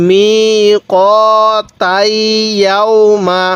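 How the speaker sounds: very slow, every syllable stretched; drawn-out reading. Shown as a demonstration. Teacher drawing out the word for students, very slowly. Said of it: "এরকমভাবে শিখব".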